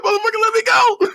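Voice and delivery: high squealed comic holler; hammed-up cry of pain; mock pained yell. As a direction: squeal it like the villain begging release. Retold villain yelling as hand cracks.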